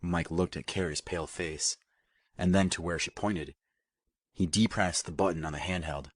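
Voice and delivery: ordinary delivery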